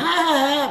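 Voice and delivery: imitating the crowd's high cackle, ah ah ah; high, hammed-up mocking laugh; a hint of people cracking up at a meme; mock laughter. Everyone laughing at the memes.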